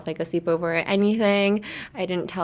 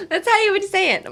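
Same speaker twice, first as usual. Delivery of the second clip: high-pitched words through real laughter; laughing; 11 semitones higher, 4 dB louder, faster than usual. Real, not acted. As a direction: insist while still cracking up. Laughing at her own demo.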